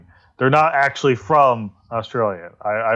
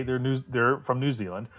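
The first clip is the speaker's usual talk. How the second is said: quiet aside; 9 dB quieter than usual. Acted. Joking that attractive Australians are Kiwis, quiet throwaway. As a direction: slip it in under your breath. Muttered aside before punchline.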